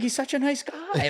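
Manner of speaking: high, incredulous Joe voice; baffled Joe impression. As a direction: play Joe puzzled that anyone dislikes him. Imitating Joe's baffled defense.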